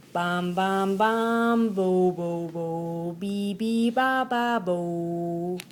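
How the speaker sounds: sung bom bom tuba notes; tuba imitation. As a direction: hum out clumsy tuba notes.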